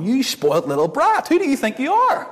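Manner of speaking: indignant scold rising very high at the end; preacher mocking his childhood self, indignant; scolding my younger self. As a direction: tell off a spoilt brat in mock outrage. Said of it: "watching this back and going".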